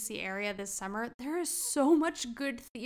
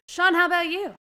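loud call-out; 9 semitones higher, 13 dB louder than usual. Real, not acted. Host calling on next guest.

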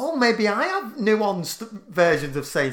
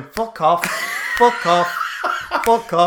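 voiced fingers; 5 dB louder, slower than usual. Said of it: "the thumb and the middle finger going".

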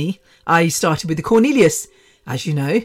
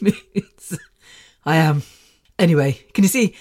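normal talking voice

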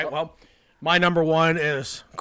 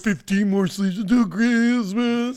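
happy and giddy; 5 semitones higher, 7 dB louder than usual. Real, not acted. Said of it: "look how happy he was".

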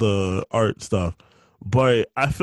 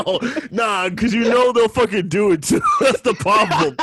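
laughing; 8 semitones higher than usual. Real, not acted. Laughing about being clipped.

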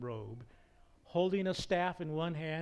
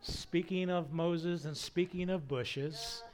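ordinary talk

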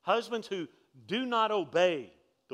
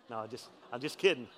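ordinary talk, nothing marked

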